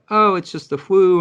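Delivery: high, loud mock of the people brushing it off as "just the flu"; mocking dismissive people. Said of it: "everyone's like".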